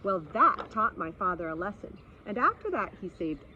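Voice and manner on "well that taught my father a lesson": ordinary delivery, nothing marked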